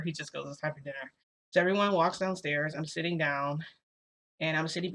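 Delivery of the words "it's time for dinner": dismissive. Retold or imitated. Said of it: "He doesn't even, like, acknowledge that I'm there".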